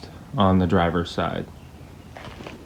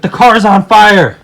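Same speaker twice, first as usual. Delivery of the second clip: panicked yell, high and loud; shouting; 10 semitones higher, 11 dB louder than usual. Retold or imitated. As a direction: yell it in alarm. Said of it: "And I was like".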